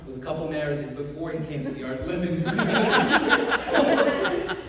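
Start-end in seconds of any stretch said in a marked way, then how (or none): 2.4-4.7 laughing. Real, not acted. Laughing about marriages.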